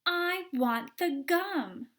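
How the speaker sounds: slow, loud, high insistence; obstinate. Acted.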